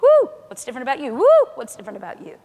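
loud whoop, then a quick question; loud, quick mock amazement; amazed onlookers. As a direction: whoop in astonishment and ask fast. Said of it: "And people get around you, they're just like".

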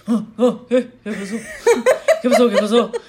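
loud, startled Spanish-speaking dad; mock startled father; groggy startled dad. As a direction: jolt awake and bark the question. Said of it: "my dad would be like".

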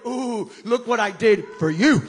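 loud, proud announcement; triumphant Jesus. Pretend. Preacher voicing Jesus to disciples, loud triumph. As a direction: proclaim the victory boldly. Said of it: "He went in there going".

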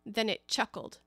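ordinary delivery

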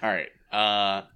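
usual speaking voice

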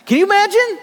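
high, loud disbelieving cry; incredulous exclaim. Real, not acted. Pastor marvelling to the congregation, high and loud. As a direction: throw it out to the room in disbelief.

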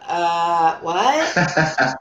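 low, drawn-out clueless "what?"; mock American. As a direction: play a clueless American, hammed-up. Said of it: "I'm gonna be like the American".

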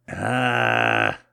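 low, loud, long groan; straining groan. Acted. Cuffed man struggling to get free, straining groan. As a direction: groan out in helpless frustration.

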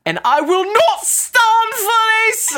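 angry, high, loud slow shout; hammed-up outburst, voice shooting way up; Nicole Kidman. Mocking an actress whose accent slips when angry, furious shout. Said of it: "When Nicole Kidman gets angry".

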